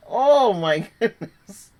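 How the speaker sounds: slow, loud, recovering from laughter; catching her breath after the outburst; breathless after laughing. Real, not acted. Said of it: "Sorry, I had to pause".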